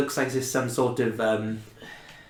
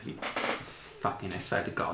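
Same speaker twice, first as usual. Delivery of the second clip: exasperated whisper; 4 semitones lower, 11 dB quieter than usual. Real, not acted. GM exasperated at player.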